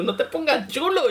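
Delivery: very high, squeaky put-down; hammed-up high warning; mock scold. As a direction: tell off a show-off in a cartoon voice. Scolding teammates who run ahead.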